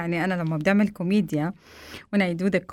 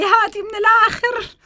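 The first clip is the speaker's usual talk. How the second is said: much higher, louder squeaky impatient man; impatient husband; 13 semitones higher, 6 dB louder than usual. Retold or imitated. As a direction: hurry her along comically. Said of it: "قال لي".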